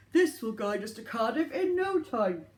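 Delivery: lightly voiced Santa. Santa speaks.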